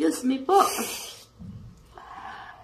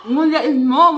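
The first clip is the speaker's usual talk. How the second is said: laughing; 4 semitones higher, 9 dB louder than usual. Real, not acted. Laughing during eating challenge.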